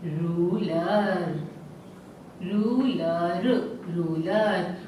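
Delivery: रूलर repeated with a long slow रू. Shown as a demonstration. Teacher to class, repeating रूलर slowly. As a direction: drag out the रू in रूलर. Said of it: "बड़े ऊ की मात्रा है".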